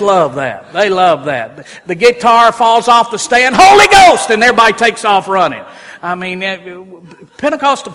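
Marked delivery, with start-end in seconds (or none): 3.6-4.3 high, loud cry of a worshipper caught up in the moment; imitating a congregant shouting out; excited cry. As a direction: cry it out as the guitar crashes. Congregant cries out in service.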